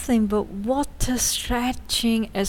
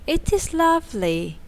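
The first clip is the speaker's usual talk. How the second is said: a touch higher, dreamy and wistful; 7 semitones higher than usual. A narrator's reading. Took admiring the stream from the boat, dreamily. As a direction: drift off into the view. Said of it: "said Took dreamily".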